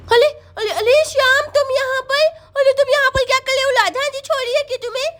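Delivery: put-on high, lisping child voice; surprised little girl. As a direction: question a playmate in baby talk. Sonam surprised to see Shyam. (हैरान तोतली बच्ची)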